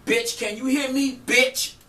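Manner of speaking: high, loud taunting insult; acted angry shout. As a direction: re-enact yelling at someone who won't listen. Acts out cursing the woman out.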